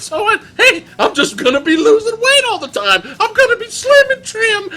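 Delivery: a monk too happy to be upset by anything, cackling; giddy happy monk. Retold or imitated. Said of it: "the happiest monk in cinema".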